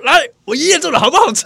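high, lively asking voice; high eager question. Pretend. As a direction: ask each one eagerly, pitched high. Acting question in dream.

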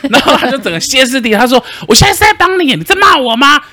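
mock the caller losing it completely; imitating a hysterical scammer. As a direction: scammer shrieking in outrage at being insulted.

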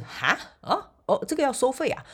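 a sharp high 啊 then a mild puzzled question; startled, puzzled "this costs money?"; surprised reaction. Retold or imitated. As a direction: replay the moment it hit you that there was a fee. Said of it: "第一个反应我是".

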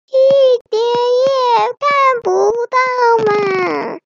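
slow, high, stretched-out grumble; complaining monkey. A narrator's reading. Monkey complains the view is blocked.

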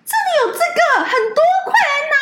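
very high-pitched mock hailing; calling out. Teacher summons a colleague to grab freebies, calling out high. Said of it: "他呼喊了B老师".

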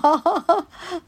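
high, bubbly giggle. Real, not acted. Host amused by a client's compliment, high giggle.

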